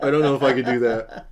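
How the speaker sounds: playful laughing refusal to his partner. Real, not acted. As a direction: tease her with a laughing refusal. Repeats teasing refusal laughing.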